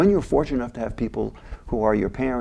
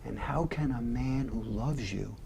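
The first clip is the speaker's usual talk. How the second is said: much softer, near-whispered conviction; hushed Archie; 11 dB quieter than usual. Retold or imitated. Archie telling Michael his father loved him, much softer. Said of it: "He says".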